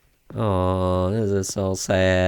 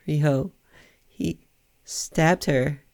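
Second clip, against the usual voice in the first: higher-pitched, halting, mildly tearful delivery; crying character; 4 semitones higher, slower than usual. Acted. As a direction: break the news haltingly, a touch tearful. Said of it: "why are you crying".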